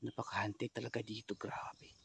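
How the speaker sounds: soft hushed whisper; spooked whisper. Real, not acted. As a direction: breathe it out quietly. Hushed remark on haunting.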